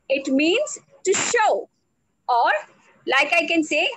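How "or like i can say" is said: usual speaking voice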